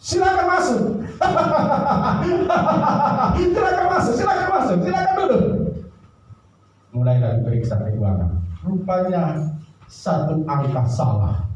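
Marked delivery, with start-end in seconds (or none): 0.0-5.5 high, forced cheerful boss. Acted. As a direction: invite them in in a high voice. (bos ceria dengan suara tinggi)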